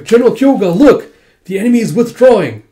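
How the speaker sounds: high, loud urgent news; excited shout. Retold or imitated. Soldier to general as enemy retreats, excited shout. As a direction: call out the sudden turn of battle.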